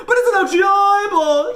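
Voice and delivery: high-pitched panicked shriek; screaming. A narrator's reading. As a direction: keep screaming through the words. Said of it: "boys screamed even louder".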